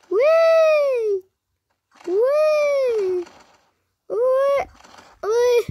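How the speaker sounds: high gleeful squeals; excited whee. Real, not acted. Flying through the air in game.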